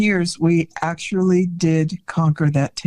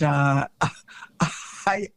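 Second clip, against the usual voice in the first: ordinary talk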